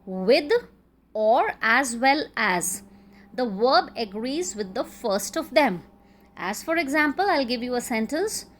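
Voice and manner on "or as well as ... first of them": slow, deliberate "or as well as"; drawn-out teacher emphasis. Shown as a demonstration. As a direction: stretch "or as well as" so it sticks. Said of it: "joined by".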